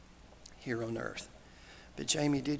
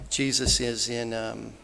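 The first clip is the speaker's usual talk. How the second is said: ordinary talk, nothing marked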